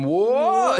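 high-pitched mock "what", laughing; startled crowd cry. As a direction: imitate everyone's startled yelp. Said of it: "everybody going".

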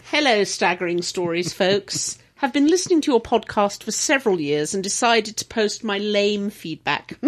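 reading a letter in a mock posh accent. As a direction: put on a posh English voice to read it out. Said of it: "Do it posh".

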